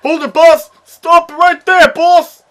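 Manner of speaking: high, loud soldier shout; yes sir military replies. Acted. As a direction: yell it like a foot soldier. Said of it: "I will do the soldier's voice".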